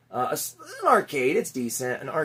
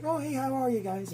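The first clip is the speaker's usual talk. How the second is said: imitated cashier; 10 semitones higher, 7 dB quieter than usual. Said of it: "she's like".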